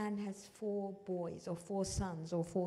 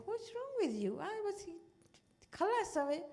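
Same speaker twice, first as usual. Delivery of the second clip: impatient comforter; 9 semitones higher, faster than usual. Retold or imitated. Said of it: "you say".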